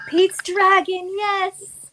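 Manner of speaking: light up as you remember the title; real thrill of recognition, drawn out and high; excited recognition. Real, not acted. Suddenly recognizing a remembered show.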